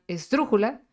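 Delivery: "esdrújula" stressed as es-DRU-ju-la; stress on antepenultimate syllable. Shown as a demonstration. Teacher showing antepenultimate stress, "esdrújula" said es-DRU-ju-la. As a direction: put the weight of "esdrújula" on DRU. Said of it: "En la antepenúltima".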